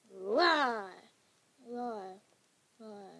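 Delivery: drawn-out animal roar, dying down; pretend roar. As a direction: open with a big roar, then let it fade. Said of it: "I just roared the whole entire".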